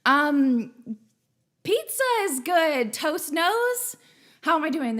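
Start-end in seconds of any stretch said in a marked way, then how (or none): 1.6-4.0 louder mock New York accent, shooing a viewer. Host greeting chat viewers, playful New York accent. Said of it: "Am I doing the New York accent all right?".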